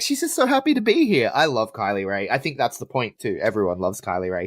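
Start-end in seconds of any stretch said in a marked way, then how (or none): 0.0-1.3 bubbly wrestler voice. Retold or imitated. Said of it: "she's just like".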